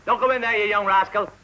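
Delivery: high, loud scolding yell; shouted warning. Acted. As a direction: yell to stop a wayward child. Shouting warning at disobedient boy.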